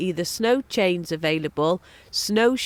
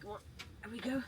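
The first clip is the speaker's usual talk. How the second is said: quiet; 16 dB quieter than usual. Real, not acted. Quietly coaxing the dog.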